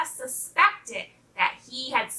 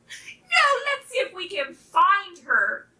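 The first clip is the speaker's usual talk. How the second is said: high, louder puppy voice; keen little pup, pitched up; eager pup; 9 semitones higher, 3 dB louder than usual. A narrator's reading. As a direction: answer high and full of eagerness. Pup eagerly answers the cockerel.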